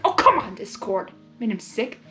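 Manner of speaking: plain reading voice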